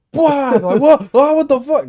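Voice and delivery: high, loud mock panic; startled yelling. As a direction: shriek in comic alarm. Acts startled by imagined helicopters.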